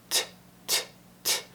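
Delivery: "T" as a bare puff of tongue-tip sound; unvoiced T. Shown as a demonstration. Teacher models the wanted consonant, unvoiced "T". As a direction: release each "T" with air only, no voice. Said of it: "unvoiced T sound".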